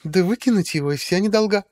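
high, affected drawling diva; drag queen. A narrator's reading. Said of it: "жеманным голосом протянула Василиса".

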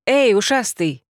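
loud call to the rabbit; calling out loudly. A narrator's reading. Heroine calling out to the hidden rabbit. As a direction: shout across the room. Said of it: "крикнула громко".

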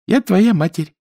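lightly voiced woman, pitched high; mother's voice. A narrator's reading. Smiling woman telling Chonkin who she is, high voice.

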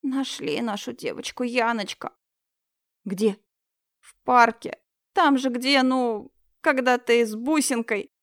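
put-on high, tearful old woman; grieving old woman. Old neighbour telling Yana the girl was found, high tearful lament.

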